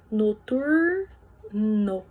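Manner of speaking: "Noturno" said very slowly, each syllable drawn out. Shown as a demonstration. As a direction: stretch out "Noturno" so the two syllables show. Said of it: "ficam em sílabas diferentes". ("Noturno" bem devagar, sílaba por sílaba)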